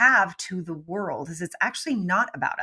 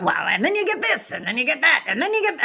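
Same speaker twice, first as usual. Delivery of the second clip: rambling seller; 5 semitones higher than usual. Retold or imitated. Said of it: "we start throwing features out".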